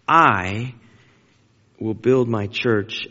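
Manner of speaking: I drawn out and set apart by a pause; stress on I. Shown as a demonstration. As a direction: land hard on "I", then slowly finish. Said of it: "I emphasized it on purpose".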